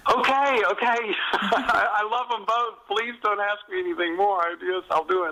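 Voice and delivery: high, hammed-up pleading give-in; high exasperated mock surrender to the producers. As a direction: squeal a mock plea to be left alone. Giving in to producers' demands.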